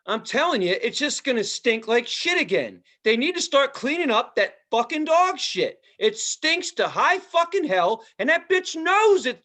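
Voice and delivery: high, loud mock rant about dog mess; ranting phone call. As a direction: imitate the owner ranting on the phone. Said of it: "she's like".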